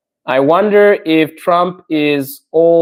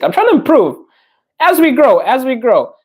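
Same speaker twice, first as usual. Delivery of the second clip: excited; 9 semitones higher than usual. Real, not acted. Excited vow to grow.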